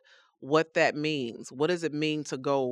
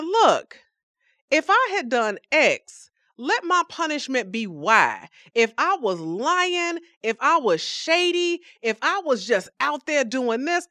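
bargaining; 7 semitones higher than usual. Acted. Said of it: "Job is bargaining".

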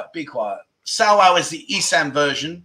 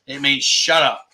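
normal talking voice

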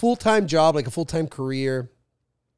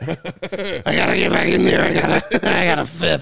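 animated sales executive; 5 dB louder than usual. Acted.

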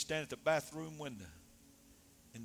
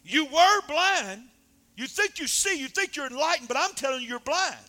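fiery preaching; 12 semitones higher, 12 dB louder than usual. Acted. Preacher calling the listeners blind, high shouted preaching. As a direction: pitch it up and hammer the charge home.

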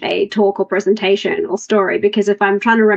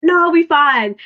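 confident brush-off; 7 semitones higher, slower than usual. Retold or imitated. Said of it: "retold reply, said twice".

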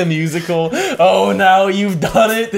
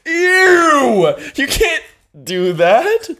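disgusted shout; 11 semitones higher, slower than usual. Real, not acted. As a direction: yell it in disgust. Disgusted outburst, loud high.

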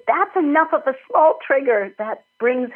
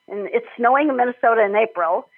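usual speaking voice

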